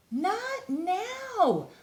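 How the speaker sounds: high little mole voice. A narrator's reading. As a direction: brush off the bear. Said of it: "moles answer bear".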